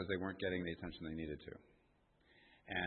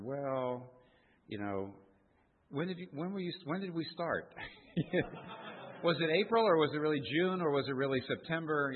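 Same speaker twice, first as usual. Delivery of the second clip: higher, louder, rising "was it April, or June"; mock evasive stammer; 7 semitones higher, 6 dB louder than usual. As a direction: fumble for the date, mock innocent. Mimicking his own evasive answer.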